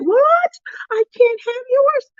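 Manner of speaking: high, wounded cry of mock shock; hammed-up hurt, pitch shooting up; hurt disbelief. Begging a friend for her cowtail and refused, hurt disbelief. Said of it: "I was so hurt".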